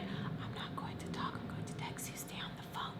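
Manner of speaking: hushed, fully whispered instructions; whispering. Retold or imitated. Telling mom's friend she will text, whispering. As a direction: whisper it secretly into the phone. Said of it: "I don't know why I whispered".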